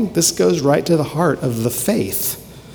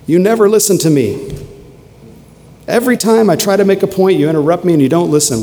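heated accusation; 6 semitones higher, 3 dB louder, faster than usual. Acted. Acted heated spouse quarrel.

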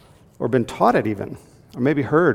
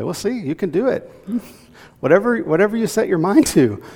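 mocking cheer; 8 semitones higher, 4 dB louder than usual. Mocking a misused verse.

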